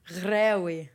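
"Grewi" with the G rasped deep in the throat; "Grewi" said the native, guttural way. Shown as a demonstration. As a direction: demonstrate "Grewi" with its throaty first sound. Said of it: "get down there".

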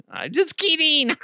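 very high, silly squeal; high-pitched joking. Acted. Character takes back the threat, high silly voice. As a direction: squeak it out as a joke. Jokey aside amid Russian impression.

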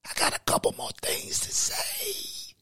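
high menacing villain whisper; evil whisper. Acted. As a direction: keep taunting in a villain's whisper. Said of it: "Why are you whispering like that?".

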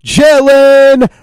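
booming arena announcer intro, drawn out; ringmaster. Shown as a demonstration. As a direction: hype up the crowd like a ringmaster. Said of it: "should have done it a little bit more like this".

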